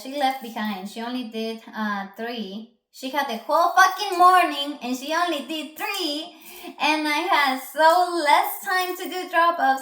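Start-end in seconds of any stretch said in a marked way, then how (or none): 2.9-9.8 loud, high exasperated complaint; angry rant. Real, not acted. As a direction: vent it loud and high at the viewers. Ranting about lazy coworker.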